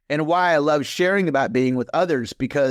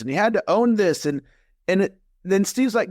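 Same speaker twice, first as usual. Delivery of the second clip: normal talking voice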